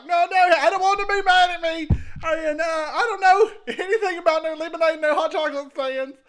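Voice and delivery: loud, laughing frantic protest; panicked loud denial. Acted. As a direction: babble your way out of trouble.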